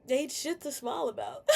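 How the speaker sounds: laughing through tears; half laugh, half sob; laugh-crying. Real, not acted. To her viewers, laughing through tears. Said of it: "laugh cry heard".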